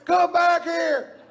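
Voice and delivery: drawn-out high yell; angry fan shout. Retold or imitated. Fan yelling at Doc as he walks away, slow high shout. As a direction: bellow it slowly after him. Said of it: "somebody going".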